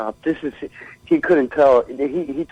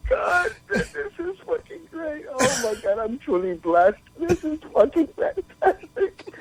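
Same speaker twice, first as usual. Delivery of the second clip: much higher, tearful laughing through the words; barely able to speak for laughing; helpless laughter; 12 semitones higher than usual. Real, not acted. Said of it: "I'm just here in tears with so much fucking laughter".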